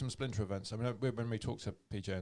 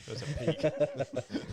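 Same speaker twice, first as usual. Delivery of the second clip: laughing; 4 semitones higher, 4 dB louder, much slower than usual. Real, not acted. Joking while laughing.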